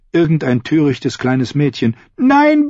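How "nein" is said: hoher, lauter Aufschrei; Schrei eines Mädchens. A narrator's reading. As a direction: schrei flehend auf. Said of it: "rief ein Mädchen". (flehendes Schreien eines Mädchens)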